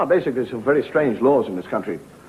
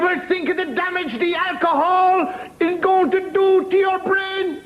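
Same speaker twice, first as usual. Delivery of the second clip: prim nun voice; 13 semitones higher than usual. Acted. Nun warns the drunk at the pub door, high and prim. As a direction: lecture him like a prim old nun.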